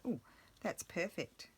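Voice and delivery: ordinary talk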